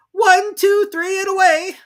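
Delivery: rising loud count; countdown. Acted. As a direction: count off a race. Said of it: "race countdown".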